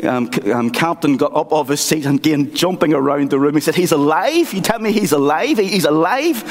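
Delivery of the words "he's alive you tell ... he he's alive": high, thrilled questions rising on alive; joyful disbelief. Retold or imitated. Captain hearing Jesus is alive, asks in high joyful disbelief. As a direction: sound like a man who just heard the best news.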